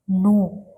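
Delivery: "नू" with a long oo; isolated "नू", vowel drawn out. Shown as a demonstration. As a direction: hold the oo in "नू", on its own. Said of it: "नू में बड़ा ऊ".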